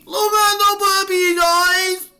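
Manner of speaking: very high, cooing puppy talk. Real, not acted. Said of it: "talk to our pets in our baby voice".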